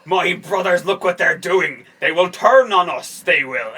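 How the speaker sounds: loud, slow mock-dramatic slipping Irish accent; Fassbender's slipping accent. Mocking an actor's Magneto warning his brothers, slipping accent. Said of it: "can't do is hold a fucking accent".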